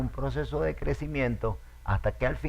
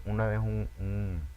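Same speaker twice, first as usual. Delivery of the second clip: ordinary talk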